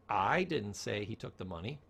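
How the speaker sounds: I stressed. Shown as a demonstration. Teacher demonstrating tone, stress on I. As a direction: lean on I. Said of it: "all I'm gonna do is change the tone".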